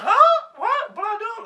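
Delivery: very loud high-pitched shriek; hammed-up stunned outburst; shocked disbelief. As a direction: shriek the questions, totally thrown. Acting out shock at rejection.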